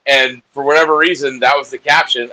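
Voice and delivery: normal talking voice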